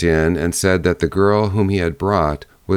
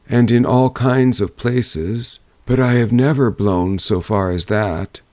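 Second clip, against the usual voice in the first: a grand, boastful south wind, pitched up; 5 semitones higher than usual. Acted.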